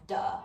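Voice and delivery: duh, TH stopped to a D; TH stopping. Shown as a demonstration. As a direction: duh with a hard D start. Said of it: "the becomes duh".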